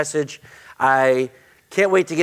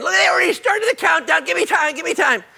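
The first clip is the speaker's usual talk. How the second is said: surprised shout; faster than usual. Real, not acted. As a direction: yelp at the countdown, then plead for time. Countdown clock started early.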